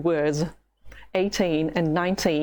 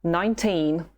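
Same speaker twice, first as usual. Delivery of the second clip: normal talking voice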